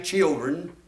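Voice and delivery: usual speaking voice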